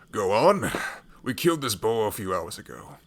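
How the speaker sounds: loud urging. Acted. Urging the boy to eat.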